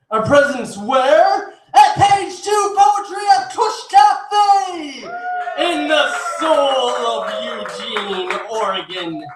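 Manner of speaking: theatrical stage intro building to a high shout; hype emcee announcer. Acted. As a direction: build the welcome like a ringmaster, with dramatic pauses. Emcee hypes up the show.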